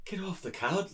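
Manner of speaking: mild mock scolding, imitating a friend's impression; Ewan McGregor impression. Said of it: "Ross jumps into his perfect McGregor".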